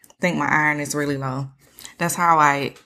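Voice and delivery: usual speaking voice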